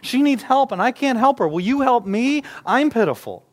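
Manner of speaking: loud, high, anguished begging; desperate plea. Retold or imitated. Mother of possessed daughter begging Jesus, loud high plea. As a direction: beg for help you cannot give. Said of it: "She says".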